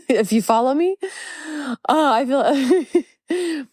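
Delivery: amused, laughing through the words. Real, not acted. Podcaster amused at her own tangled explanation, laughing. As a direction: let a real laugh break into the aside.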